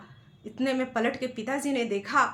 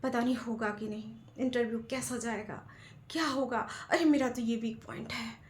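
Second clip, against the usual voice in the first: inner worry; 8 dB quieter than usual. Retold or imitated. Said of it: "मन में सोचते जाते हैं".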